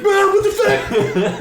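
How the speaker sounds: very high, loud frantic shout; mock panicked yell. He flees his lunging mom, high panicked mock yell. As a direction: yell like someone under attack, hammed up.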